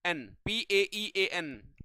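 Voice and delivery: letter by letter, slow and clear; slow dictation of the spelling P-E-A-N; spelled out slowly. Shown as a demonstration. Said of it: "इसका उच्चारण आप लोग लिख लीजिएगा".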